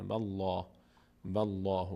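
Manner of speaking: والله with a dark, full L and deep aw-like آ; full-mouthed lam in والله; heavy lam. Shown as a demonstration. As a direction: mouth opened upward on the لا of والله.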